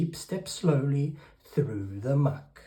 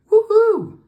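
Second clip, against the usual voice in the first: loud, very high whoop; high, celebratory storybook cheer; gleeful cheer; 14 dB louder than usual. A narrator's reading. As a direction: whoop it out as the freed duck. Duck cheering as he drives off.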